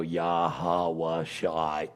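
Yahweh Shai drawn out very slowly, syllable by syllable; stiff reading. Retold or imitated. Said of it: "like that".

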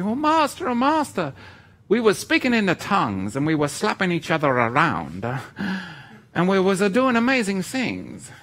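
high, mock-pleading Italian accent; silly Italian-accented impression of pleading followers; accidental Italian accent. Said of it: "why I'm speaking Italian".

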